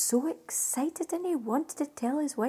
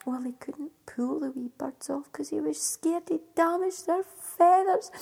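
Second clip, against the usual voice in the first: plain reading voice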